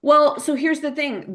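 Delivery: normal talking voice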